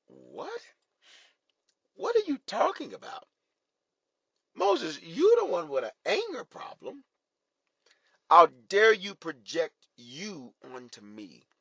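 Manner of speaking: incredulous, high-pitched comeback; indignant Lord. Acted. The Lord answering Moses's accusation, high indignant disbelief. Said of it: "And the Lord was like".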